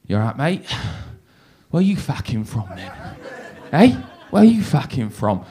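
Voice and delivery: low, quiet menace of a hard man; softly spoken, low-pitched tough-guy voice; tough London lad. Retold or imitated. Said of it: "a big, tough-looking guy".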